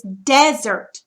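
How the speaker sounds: desert said DEZ-ert, front stressed; first-syllable stress. Shown as a demonstration. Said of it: "accent mark goes over the S".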